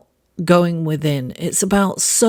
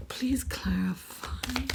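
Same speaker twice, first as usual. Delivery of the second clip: whispered plea; 5 semitones higher, 14 dB quieter, slower than usual. Real, not acted. Whispered request to tarot cards.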